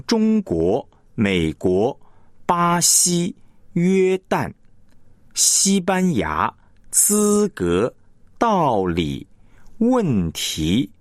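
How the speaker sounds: both syllables of 中国, 巴西, 资格 equally full and held; equal stress. Shown as a demonstration. Teacher demonstrates parallel stress to listeners, syllables evenly weighted. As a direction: give each character in 中国, 美国, 问题 the same weight. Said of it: "它前后同样重的一个".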